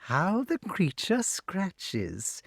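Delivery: high, slow witch croon; amused witch. A narrator's reading. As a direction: marvel with drawn-out wicked relish. Voices Madam Mim.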